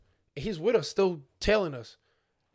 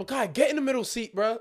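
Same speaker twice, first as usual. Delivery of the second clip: higher, playful teasing call; teasing shout; 7 semitones higher than usual. Retold or imitated. Friends tweaking on Makai.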